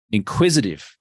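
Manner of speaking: ordinary talk, nothing marked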